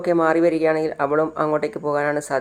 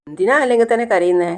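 higher-pitched, mildly exasperated; acted impatient question; 5 semitones higher than usual. Patient questioning her mother's tears, mildly impatient. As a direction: play it pushy, pitched up. Sarayu challenges the crying.

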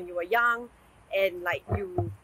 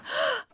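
shocked gasp; 13 semitones higher than usual. Real, not acted. Shocked at sugar mama.

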